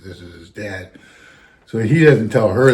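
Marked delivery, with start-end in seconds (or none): 0.0-0.9 soft, low murmur of realization; hushed aside. Retold or imitated. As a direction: say it under your breath, just to yourself.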